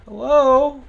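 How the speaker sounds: loud startled hello; calling out. Real, not acted. Gamer calls out to an unseen stranger, loud. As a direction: call out into the dark.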